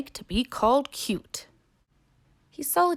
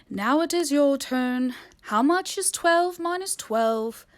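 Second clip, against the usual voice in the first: owl voice; 6 semitones higher, 3 dB louder, slower than usual. Acted. Owl quizzes hamburger.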